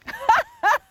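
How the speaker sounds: short high laugh. Real, not acted. Laughs at her own answer.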